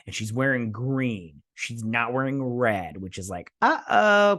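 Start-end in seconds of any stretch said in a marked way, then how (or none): 3.6-4.4 uh-oh. Real, not acted. Queen wears green, trouble.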